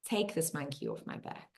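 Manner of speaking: soft, low exhaled plea; quiet surrender. Shown as a demonstration. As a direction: hand it over gently on the out-breath. Repeats it after exhaling.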